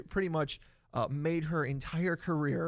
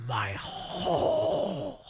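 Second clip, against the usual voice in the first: very slow, drawn-out boss impression; Vince McMahon; 4 semitones higher, much slower than usual. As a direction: stretch it out, higher, like a promoter's grand reveal. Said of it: "that's my Vince McMahon impression".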